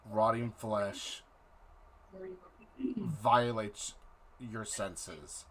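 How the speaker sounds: dramatic scene-setting narration; game master narration. Acted. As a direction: set the dark scene slowly.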